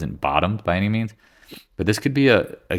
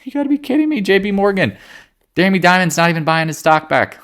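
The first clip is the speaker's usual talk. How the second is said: high, loud incredulous rant; can't believe what he sees; excited rant; 13 semitones higher, 3 dB louder than usual. Real, not acted. Excited rant about banks.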